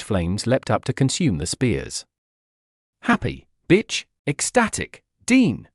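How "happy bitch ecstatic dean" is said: high-pitched gleeful cheer. A narrator's reading. Villainess crowing over the destroyed spears, cheering. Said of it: "she cheered".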